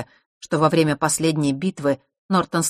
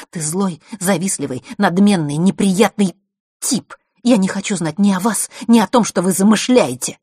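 angry insults; 4 semitones higher, 6 dB louder than usual. A narrator's reading. Vasilisa throws insults back at Mark, angrily. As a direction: list of insults building louder.

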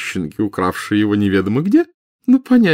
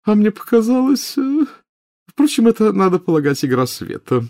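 nervous; 14 semitones higher, 4 dB louder than usual. A narrator's reading. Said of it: "заметно нервничая сказала Хепзиба".

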